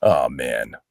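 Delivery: low, soft dopey groan; dumb sigh. Retold or imitated. Co-star playing dumb character, low dopey groan. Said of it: "he was kinda like".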